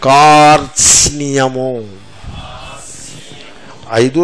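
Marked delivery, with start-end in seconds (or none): 0.0-1.7 కార్త్స్నియము slowly, r-t-s-n-y all present; long kaar, clear t-s-ni-ya in కార్త్స్నియము; correct full cluster. Shown as a demonstration. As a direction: stretch కార్త్స్నియము, losing no consonant. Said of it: "అలా పోకూడదు".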